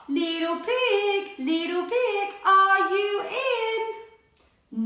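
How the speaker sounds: drawn-out, sing-song call to the pig. Acted. Wolf calling sweetly at the pig's door. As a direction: stretch it slowly like a teasing call. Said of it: "so I called".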